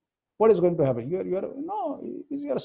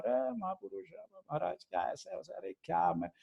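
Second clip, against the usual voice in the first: put-on soft, private murmur to an old friend; confidential aside; 9 dB quieter, faster than usual. Swami's friend greeting him alone in a corner, confidential and soft. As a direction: drop the volume for a private word. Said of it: "when I am alone or just in some corner".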